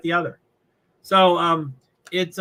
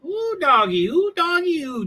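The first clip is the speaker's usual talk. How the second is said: sing-song excitement; 11 semitones higher than usual. Real, not acted. Exclaiming in delight.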